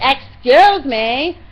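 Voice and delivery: very loud, drawn-out mock yell; loud, rude imitation. Woman trying to push past her on the bus, loud mimicry. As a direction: mimic a pushy passenger, stretching the words loudly. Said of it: "being loud like that".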